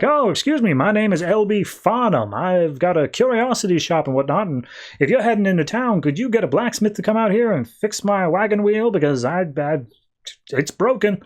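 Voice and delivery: high, fussy stranded merchant voice; L B Farnham voice. Retold or imitated. Stranded wagon owner asks passing travellers for help, high fussy voice. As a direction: play a flustered little shopkeeper begging for help.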